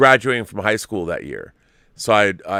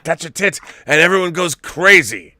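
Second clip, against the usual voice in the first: put-on higher Dutch-accented punchline; imitating a Dutch director, louder at the end; 6 semitones higher, 4 dB louder than usual. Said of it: "in his Dutch brogue or whatever".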